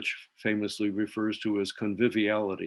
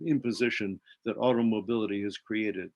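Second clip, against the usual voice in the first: ordinary delivery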